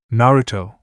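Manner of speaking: ordinary delivery, nothing marked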